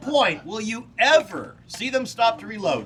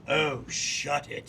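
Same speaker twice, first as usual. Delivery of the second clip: slow, lazy pirate drawl; Captain Jack Sparrow; 5 semitones lower, 3 dB quieter, much slower than usual. Retold or imitated. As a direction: brush them off like a lazy pirate. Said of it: "quote Captain Jack Sparrow".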